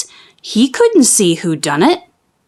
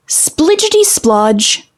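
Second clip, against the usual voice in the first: higher, slow splidgety splodge; squelch sound; 6 semitones higher, slower than usual. A narrator's reading. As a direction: squelch it out slowly. Pig's dropping sound.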